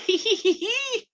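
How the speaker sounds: high mocking giggle, hee hee; a cheeky high titter as she cheers it on in chat; gleeful cackle. Recalling cheering in the chat, a gleeful high mocking giggle. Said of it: "I was like".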